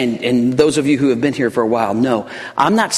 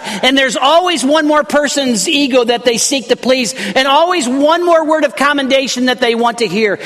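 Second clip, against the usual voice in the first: higher, louder fervent sermon delivery; full-force preaching; 10 semitones higher, 3 dB louder than usual. Acted. Pastor warning congregation about puffed-up people, fervent preaching. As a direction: preach it out to the back pews.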